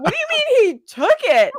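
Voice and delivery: high, loud mock outrage; mock disbelief. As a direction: squeal it in fake disbelief. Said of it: "everyone was like".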